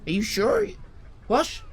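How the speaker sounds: high-pitched, mildly baffled reply; retold disbelief. Retelling his baffled reply to compliment.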